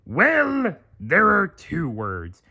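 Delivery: high put-on crab boss voice; Mister Krabs voice. A narrator's reading. Mister Krabs breaking bad news, put-on voice. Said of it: "Mister Krabs replied".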